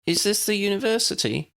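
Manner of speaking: put-on high young girl's voice, asking; Lydia's voice. A narrator's reading. Lydia asks Harry at the red brick building, high and curious. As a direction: lift it up into a light girl's question. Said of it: "asked Lydia".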